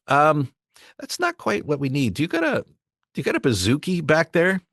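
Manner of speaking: mock a producer's odd request, pitch up; clueless producer.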